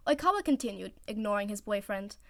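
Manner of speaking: ordinary delivery, nothing marked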